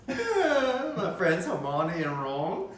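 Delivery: put-on slow, laughing try at a British accent; a lightly put-on British accent; bad British accent. Asked to talk about Hermione and Ron, weak British accent. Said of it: "Maybe you should talk about your friends".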